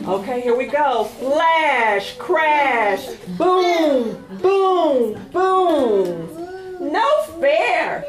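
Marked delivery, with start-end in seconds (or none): 1.0-6.3 dramatic thunder refrain; thunder booms. Acted. 6.9-8.0 whiny protest. Acted. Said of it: "cried Sis".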